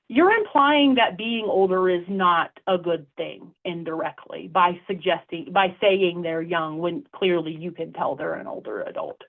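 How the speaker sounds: ordinary talk, nothing marked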